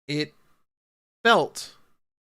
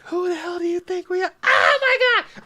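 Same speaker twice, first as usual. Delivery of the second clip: much higher and louder, shrieking with glee; hyped-up battle cry; 7 dB louder than usual. Retold or imitated. Quoting the anime's finale to chat, ecstatic yell. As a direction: yell it like the hero's big line.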